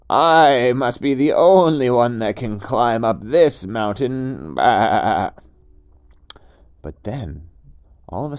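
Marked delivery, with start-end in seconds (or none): 0.1-4.6 boastful goat. Acted. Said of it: "the goat thought to itself". 4.6-5.3 goat bleat. Acted.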